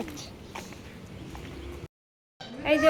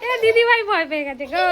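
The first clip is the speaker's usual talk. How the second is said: excited call; 6 semitones higher, 7 dB louder than usual. Real, not acted. Mother calls out, daughter scared.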